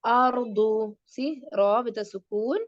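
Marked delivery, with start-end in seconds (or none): 0.0-1.0 Ardu with a full heavy ra. Shown as a demonstration. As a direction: say Ardu with a thick ra. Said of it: "ar, heavy".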